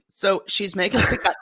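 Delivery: ordinary delivery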